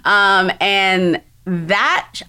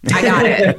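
excited realization; 4 semitones higher, 4 dB louder than usual. Retold or imitated. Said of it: "I was like".

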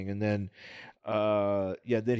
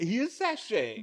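camp voice; 9 semitones higher, slower than usual. Pretend. Camp description.

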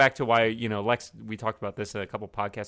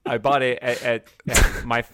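ordinary talk

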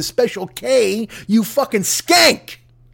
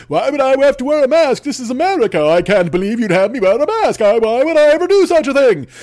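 lightly whiny entitled shopper voice, pitched higher; mock entitled anti-masker; 4 semitones higher, 4 dB louder than usual. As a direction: sneer it like a spoiled customer who won't wear a mask.

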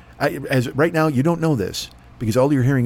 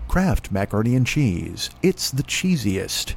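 voiceover announcer; 2 semitones lower, slower than usual. Pretend. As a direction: savor each word like a TV ad.

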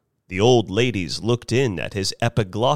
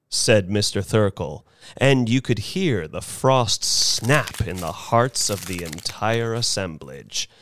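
usual speaking voice

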